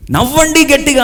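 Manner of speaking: high-pitched mock command to a crowd; imitated rallying call. Organiser ordering the crowd to laugh, imitated high call. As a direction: call it out like an organiser forcing cheer. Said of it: "అన్నారు".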